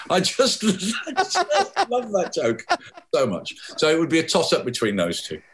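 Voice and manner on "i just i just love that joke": laughing. Real, not acted. Laughs at own joke.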